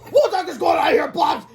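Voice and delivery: high, loud outraged yell; angry scream. A narrator's reading. Enraged man screams at the boys, high and loud. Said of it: "he screamed".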